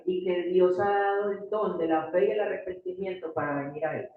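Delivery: ordinary talk, nothing marked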